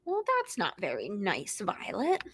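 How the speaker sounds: soft mock scolding; gentle scold. Real, not acted. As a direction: tut at the cat gently. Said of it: "reader gently scolds Violet".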